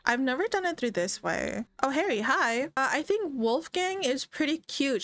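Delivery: usual speaking voice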